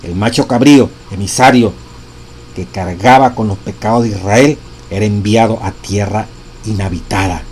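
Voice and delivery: preacher proclaiming the text; solemn reading aloud. Acted. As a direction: read each phrase with gravity. Continues reading the quote.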